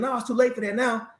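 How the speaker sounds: high-pitched, abrupt commanding general; voiced commander. General refusing the salute.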